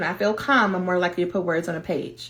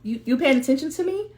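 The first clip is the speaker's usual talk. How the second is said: high, quick, taken-aback little voice; mock surprise, pitched up and hurried; surprised inner voice; 6 semitones higher, faster than usual. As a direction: act startled at finally being noticed. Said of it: "It's like".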